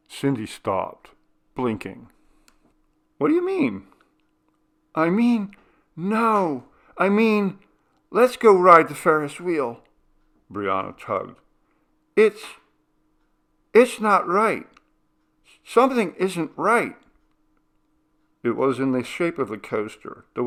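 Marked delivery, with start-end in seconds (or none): 3.2-9.8 high, loud, frightened insistence; anxious pleading. Acted. Scared girl begging her sister, high and loud. 12.2-17.0 high, loud, frightened insistence; anxious pleading. Acted. Scared girl begging her sister, high and loud. Brianna senses danger, pleads.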